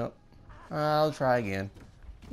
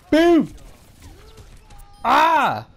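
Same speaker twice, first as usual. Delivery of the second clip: sudden loud yell, much higher; hype yell; 11 semitones higher, 12 dB louder than usual. Real, not acted. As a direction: blurt it out at full volume. Landing a big play.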